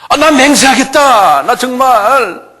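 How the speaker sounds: high, loud, drawn-out swearing; vehement oath. Acted. Peter swears to his accusers, vehement high protest. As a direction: swear it loudly, dragging the words.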